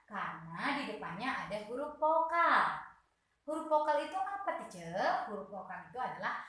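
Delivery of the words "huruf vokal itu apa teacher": put-on pitched-up child asking the teacher; murid bertanya. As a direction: play a curious pupil, higher and quicker. Guru memerankan murid bertanya. (suara anak bertanya dengan nada tinggi)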